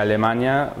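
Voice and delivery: ordinary talk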